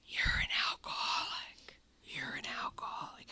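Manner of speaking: eerie whispered inner voice; small still voice, whispered. Retold or imitated. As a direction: whisper it like a voice in your head. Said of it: "this little voice".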